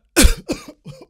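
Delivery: sudden harsh choking cough. Real, not acted. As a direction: cough hard like it went down the wrong pipe. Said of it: "That wasn't a covid cough".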